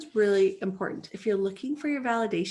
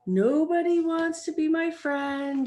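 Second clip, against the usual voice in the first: mopey thought; 6 semitones higher, 5 dB louder, slower than usual. Retold or imitated. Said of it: "thinking these thoughts like".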